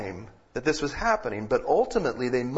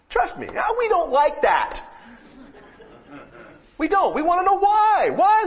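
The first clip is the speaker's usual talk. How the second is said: much higher, louder cry to the Lord; impassioned preaching; 6 dB louder than usual. Acted. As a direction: cry out the why, high and loud, pleading. Climax of sermon, crying out why.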